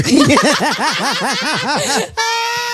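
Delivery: high-pitched burst of laughter; helpless high cackle; shrieking laughter. Real, not acted. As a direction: burst into a long, squealing laugh. Friend teased with an insult, bursts out.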